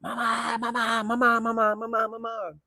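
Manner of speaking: put-on high, whiny child calling mama; whining kid. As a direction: call for mama like a little kid. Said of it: "and he just".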